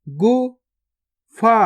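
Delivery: गुफा drawn out very slowly, each sound held long; slow stretched reading. Shown as a demonstration. Teacher showing pupils each sound, drawn out very slowly. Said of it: "पहले तो आपको शब्द को गौर से सुनना है".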